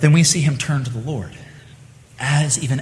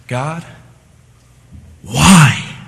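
anguished why; 6 dB louder than usual. Acted. Voicing psalmist's question.

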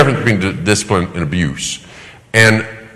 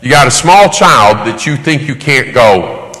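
ordinary talk, nothing marked

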